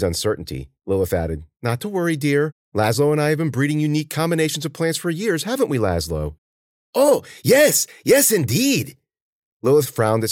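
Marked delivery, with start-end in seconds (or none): 1.6-6.4 lightly voiced old lady, raised pitch; reassuring old woman. 6.9-9.0 very high, loud servant voice; eager agreement. Acted.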